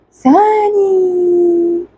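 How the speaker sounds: high gasp then coo. Real, not acted. Cooing at baby.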